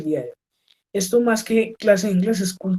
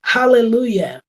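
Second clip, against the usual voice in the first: joyful exclamation; 4 semitones higher, 6 dB louder, slower than usual. Shown as a demonstration. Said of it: "Bueno, un montón".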